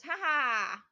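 loud gloating cackle; witch cackle. A narrator's reading. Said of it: "laughed the witch".

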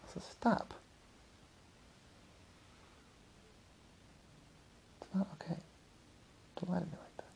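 very soft, whispered aside; real hushed reprimand, kept under the breath; hushed scolding. Real, not acted. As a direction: quietly tell someone off away from the story. Hushed aside scolding someone nearby.

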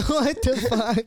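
high, loud laughing "what the fuck"; laughing disbelief. Real, not acted. Cohost reacting to the naked hallway story, laughing in disbelief. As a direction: crack up in disbelief.

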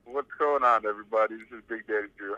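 ordinary talk, nothing marked

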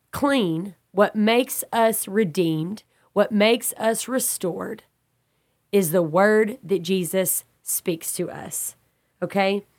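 usual speaking voice

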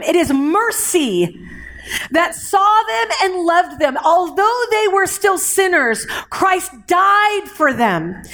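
emphatic preacher's delivery with ringing key words; fervent preaching. Real, not acted. Preacher rallying brothers and sisters about mercy and grace, fervent. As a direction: preach it with conviction, phrase by phrase.

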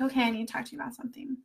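fast, hushed whisper; quick whisper. Retold or imitated. Calling her mom with bad news, fast whisper. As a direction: rush it out under your breath. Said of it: "I was like".

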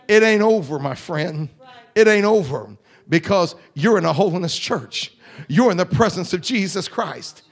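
loud, rising revival preaching; preaching at full force. Acted. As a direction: lift the congregation with loud fervor.

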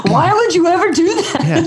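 mocking. Mocking what other people say.

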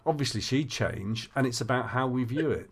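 ordinary talk

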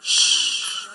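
sharp voiceless "shh"; long hushing hiss; shushing. Acted. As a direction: hush a noisy man. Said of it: "the people came and said".

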